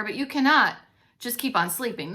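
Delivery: usual speaking voice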